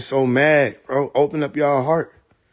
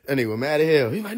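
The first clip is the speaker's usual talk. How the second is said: ordinary delivery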